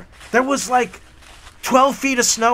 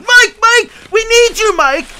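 high loud panicked calls; panicked yelling; 14 semitones higher, 9 dB louder than usual. Retold or imitated. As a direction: yell for help, panicking. Kids running down yelling.

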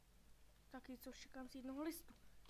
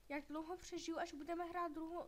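normal talking voice

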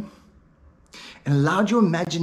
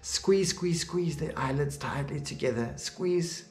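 insistent coaching; 8 dB quieter than usual. Real, not acted. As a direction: press them on through the effort, word after word. Coaching listener to tense the eyelids.

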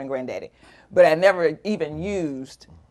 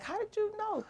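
high, small squeak of disbelief; amazed; 11 dB quieter than usual. Retold or imitated. Wife amazed her husband guessed the news, high soft squeal. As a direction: squeak it softly, astonished he guessed.

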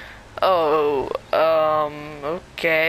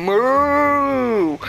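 mooing cow. Acted. Cow imitation.

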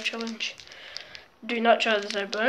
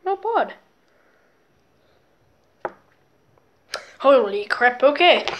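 after eating wasabi; 4 semitones higher, 7 dB louder than usual. Real, not acted. Just tasted wasabi, burning.

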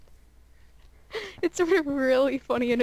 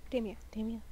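soft whispered coaxing; soothing whisper; 3 semitones lower, 10 dB quieter, faster than usual. Real, not acted. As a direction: whisper like calming a pet. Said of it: "You're talking to me like I'm an animal".

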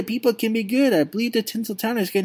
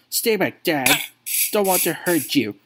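ordinary talk

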